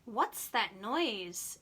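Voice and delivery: plain reading voice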